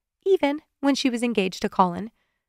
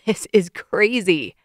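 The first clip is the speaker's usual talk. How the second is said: 1 wheezing laugh; slower than usual. A narrator's reading. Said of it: "he wheezed".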